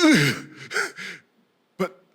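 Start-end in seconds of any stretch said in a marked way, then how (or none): 0.0-0.5 loud exasperated "ugh"; strained, stumped grunt; frustrated groan. Retold or imitated. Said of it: "I was like".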